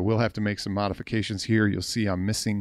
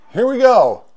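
much higher, much louder burst of excitement; excited shout; 13 semitones higher, 12 dB louder than usual. Real, not acted. Kicking off the cabinet test, real excited shout. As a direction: belt it out as the test begins.

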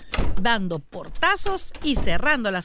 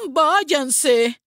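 loud, raised cry; loud, higher-pitched yell; woman's shout; 5 semitones higher, 7 dB louder than usual. A narrator's reading. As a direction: shoo the birds off angrily. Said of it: "gritó una mujer mirando a los cuervos".

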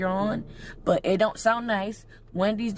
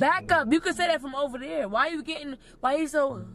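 higher-pitched irritated protest; exasperated; 5 semitones higher than usual. Real, not acted. Friend objects to being hovered over, exasperated. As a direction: tell them off, really bothered.

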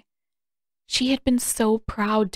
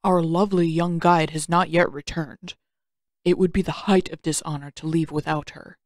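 put-on calm old uncle; 2 semitones lower than usual. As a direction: speak as a patient old mentor.

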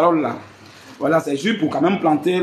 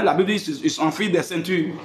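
ordinary talk

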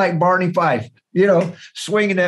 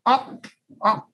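raven call; 9 semitones higher, slower than usual. Retold or imitated. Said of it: "he's looking down and going".

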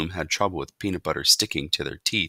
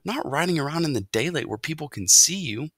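put-on higher, lively voice of a concerned boy; boy character, cautioning; 10 semitones higher, 4 dB louder than usual. A narrator's reading. Boy tells mouse to stay hidden by day, cautioning. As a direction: voice a boy telling someone off.

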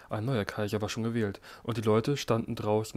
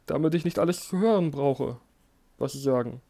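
higher, louder pleading; calling out; 4 semitones higher, 7 dB louder than usual. A narrator's reading. Emperor shouts so he need not hear. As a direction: cry out to drown the whispers. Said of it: "rief er".

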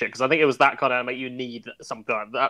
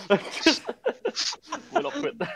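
laughing high voice; 11 semitones higher, 5 dB quieter, much slower than usual. Retold or imitated. Quoting the video, laughing.

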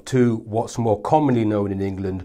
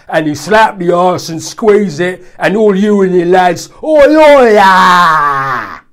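loud, high laddish build to a yell; hammed-up pub chant; mock lad's shout; 8 semitones higher, 12 dB louder, slower than usual. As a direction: bellow it like a drunk lad in a bar. Mocking lads in a bar.

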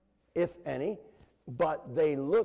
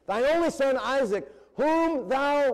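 high, loud proclamation of the verse; booming scripture reading; 10 semitones higher, 6 dB louder than usual. Acted. Preacher declaiming God's call to Abraham, high and loud. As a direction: ring it out like a prophet declaring God's words.

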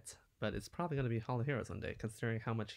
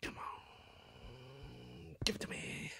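soft, pleading whisper; whispered plea; 7 dB quieter, much slower than usual. Real, not acted. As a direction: beg the game under your breath. Pleading with the summon.

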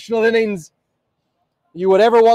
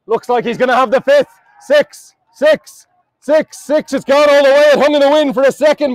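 raised-pitch, excited live cricket call; excited build-up; 4 semitones higher than usual. Real, not acted. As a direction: build the suspense toward history. Commentator building to record final ball.

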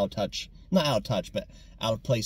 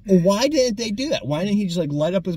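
incredulous; 8 semitones higher, 5 dB louder than usual. Real, not acted. Incredulous outburst about movie.

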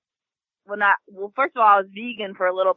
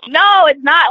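loud, higher no; loud protest; 6 semitones higher, 10 dB louder, slower than usual. Real, not acted. Player protesting friend's cheating claim, loud and higher. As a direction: push back hard.

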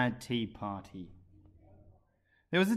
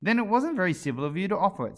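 indignant, louder and higher; angry; 8 semitones higher, 5 dB louder than usual. A narrator's reading. Alice angrily scolding the March Hare, louder. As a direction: scold him for his rudeness. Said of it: "said Alice angrily".

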